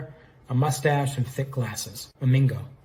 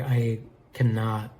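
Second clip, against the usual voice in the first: plain reading voice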